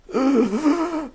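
high, sharp inhale of nerves; nervous gasp. Real, not acted. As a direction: let the nerves out in one gasp. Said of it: "all day I've been nervous".

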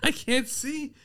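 high, breathless through laughter; laughing. Real, not acted. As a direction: squeeze the words out while laughing. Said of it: "My eyes are tearing up from laughing".

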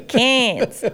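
Cairns drawn out Queensland style; Queensland accent. Shown as a demonstration. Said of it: "so I'm gonna put on an accent for this".

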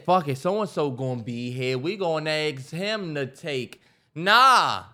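ordinary delivery

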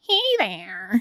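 lightly voiced witch; a hint of a cackling witch. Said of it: "it didn't sound like a witch".

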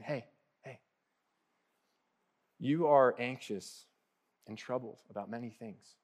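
hushed, caring address; tender. Retold or imitated. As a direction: get her attention with care, not scolding. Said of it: "Jesus really being tender".

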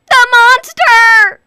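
loud, high, slow panicked cry; terrified cry. Acted. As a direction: shriek it in fear. Mother terrified.

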